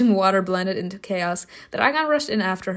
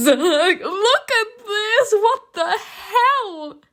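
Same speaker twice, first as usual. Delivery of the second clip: very high, loud, drawn-out squeal; shocked outcry; 13 semitones higher, 5 dB louder, much slower than usual. Real, not acted. Player shocked by the game's reveal, high wailing outcry. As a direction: wail it slowly in disbelief. Shocked at who appears in game.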